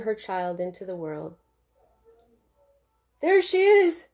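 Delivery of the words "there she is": loud, high joyful yell; excited yell. A narrator's reading. New father yelling with joy at the birth. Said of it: "yelled Jim".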